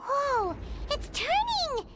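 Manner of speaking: sudden startled rise on the turning; surprised. Acted. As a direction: caught off guard as it moves.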